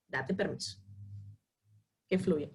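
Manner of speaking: soft, low, soothing permission; soft, low reassurance to a crying client; gentle permission. Shown as a demonstration. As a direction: let her cry, softly.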